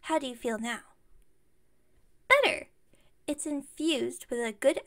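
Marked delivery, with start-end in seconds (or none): 2.3-2.7 high, loud burst of delight; delighted exclamation. Pretend. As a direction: pop it out high and pleased. Answering how the bear makes listener feel.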